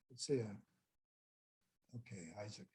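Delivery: barely audible, low aside; hushed aside. Real, not acted. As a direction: let the voice fall away to yourself. Aside while sorting out the slides.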